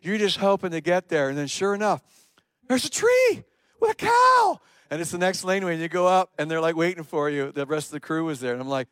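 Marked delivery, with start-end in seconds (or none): 2.7-4.6 very high, loud, drawn-out cry of discovery; excited shout. Retold or imitated. Lost driver spotting the tree with a cow, excited shout. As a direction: shout it in mock amazement. Spotting the absurd landmark, retold.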